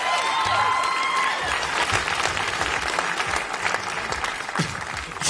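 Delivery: long breathy fit of laughter; long high laugh. Real, not acted. Comic laughing at his own bit, high wheezy laugh. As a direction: crack up laughing at the crowd.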